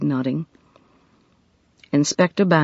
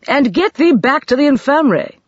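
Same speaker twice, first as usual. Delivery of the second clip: raised, higher, louder call; shouted order; 10 semitones higher, 5 dB louder than usual. A narrator's reading. Doctor calls after Katie while leaving, a loud shout. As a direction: call after her as he goes. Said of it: "shouted Doctor Merrick".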